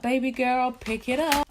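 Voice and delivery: high, sassy self-encouragement to hurry; urging herself on like a bestie; self pep talk. Retold or imitated. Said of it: "And I just felt like".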